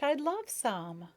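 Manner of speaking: keen, drawn-out yes; eager. A narrator's reading. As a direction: linger on it with delight. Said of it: "Maya burst out".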